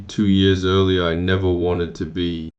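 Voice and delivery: plain reading voice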